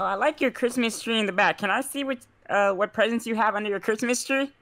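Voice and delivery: usual speaking voice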